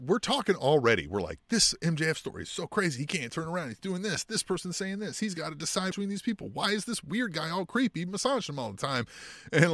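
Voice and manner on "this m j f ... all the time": excited fan chatter. Retold or imitated. Fans voiced chattering excitedly.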